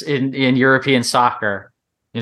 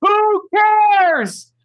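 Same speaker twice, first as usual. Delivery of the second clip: shouting; much slower than usual. Real, not acted. Fan dismissing the regular season.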